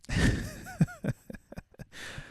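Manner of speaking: high, airy laughter; long high giggle. Real, not acted. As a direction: laugh at your own aside. Laughs after her own joke.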